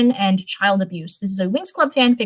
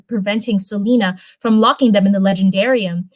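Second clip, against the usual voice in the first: ordinary delivery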